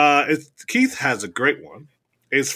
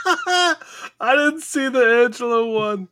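laughing; 11 semitones higher than usual. Real, not acted. Excited reaction to coaster news.

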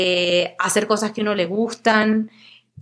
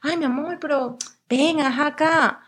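imitating boyfriend; 6 semitones higher, slower than usual. Boyfriend soothing her during anxiety, mock cooing. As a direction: coo it like a doting boyfriend. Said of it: "Él".

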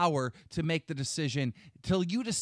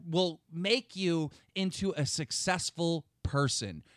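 ordinary delivery

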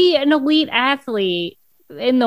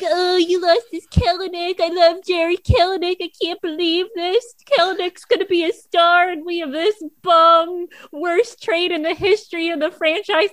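higher-pitched voice of an outraged fan; put-on fan rant, pitched up; mock complaining fan; 5 semitones higher than usual. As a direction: imitate a fan lamenting a bad trade. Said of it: "I love the voice you just said that in".

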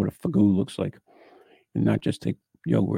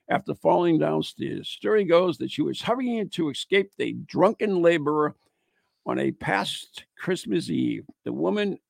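higher-pitched read-aloud narration; ghost story read aloud; 6 semitones higher than usual. Acted. Host reading of a haunted farm girl, narrating. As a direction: read it out as a pub legend.

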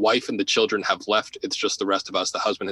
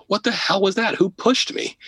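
much higher, startled yelp of a question; shocked outburst; 10 semitones higher than usual. Retold or imitated. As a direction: blurt it out as if just shoved from behind. Investigator shoved into staircase.

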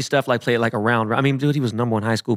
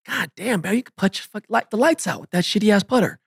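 higher, incredulous exclamation; amazed, retold; 10 semitones higher than usual. Player marvelling at Rahm's putting, pitched high and amazed. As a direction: marvel at the impossible putts.

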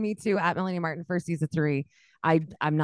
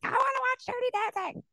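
high mock voice; 4 dB quieter, faster than usual. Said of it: "I was like".

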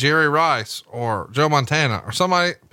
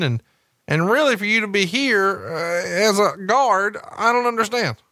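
wrestling announcer voice; 7 semitones higher than usual. Pretend. Mock wrestling announcer interview.

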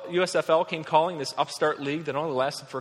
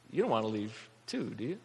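hesitant, wary question; 7 semitones lower, 8 dB quieter than usual. Acted. Acting residents anxiously asking each other.

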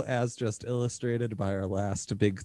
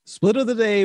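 segment announcer; 10 semitones higher, 7 dB louder than usual. Acted.

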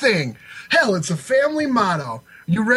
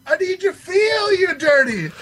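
pleading shout; 11 semitones higher than usual. Real, not acted. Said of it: "urging partner to yell".

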